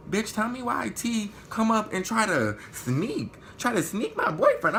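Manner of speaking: high, slow and laughing in outrage; outraged disbelief. Real, not acted. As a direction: squeal it in disbelief. Outraged retelling.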